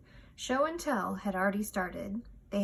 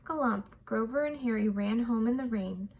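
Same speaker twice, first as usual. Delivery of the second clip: ordinary delivery, nothing marked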